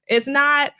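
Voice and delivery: ordinary delivery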